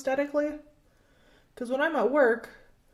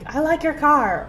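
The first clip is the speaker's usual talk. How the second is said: higher, louder mock enthusiasm; imitated excited stranger; 6 semitones higher, 7 dB louder than usual. Random people running over to admire her car, mimicked excitement. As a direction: call it out like an excited passerby. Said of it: "random people on the street are excited".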